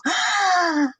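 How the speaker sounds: high gasped ah, drawn inward; scared gasp. Shown as a demonstration. Therapist modelling a frightened inhaled ah. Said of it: "como que estamos asustados".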